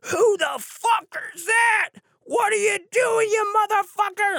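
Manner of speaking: high, loud screamed imitation of an angry stranger; full-tilt high-pitched mock tirade; enraged yelling voice. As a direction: screech it like a furious drunk woman. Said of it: "I hear this voice".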